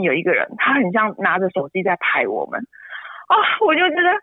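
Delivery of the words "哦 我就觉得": higher, teary complaint; pleading through tears to be left alone; tearful exasperation. Real, not acted. Mother upset at a stranger filming them, tearful.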